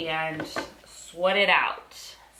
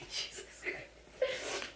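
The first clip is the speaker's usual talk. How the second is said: whispered laugh; 14 dB quieter, much slower than usual. Real, not acted. Whispered laughing under dog.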